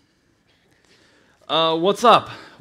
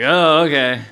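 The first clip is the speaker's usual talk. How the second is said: raised, louder "oh, okay"; a bit taken aback; mock surprised; 5 semitones higher, 11 dB louder, slower than usual. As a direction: act caught off guard. Mock surprised reply to Chris.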